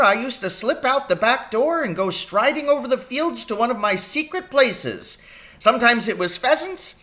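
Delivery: a brisk country doctor fussing over his patient; little old doctor voice. A narrator's reading. Doctor asking his injured patient about the pain. Doctor recounts poaching nights.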